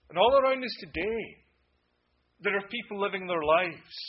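loud, high fiery sermon voice; urgent preacher warning of coming wrath; preaching at full force. Acted. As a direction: thunder it from the pulpit. Preaching to the congregation.

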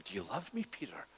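a hushed whisper of the third asking; whispered question. Acted. Jesus asks the third time.

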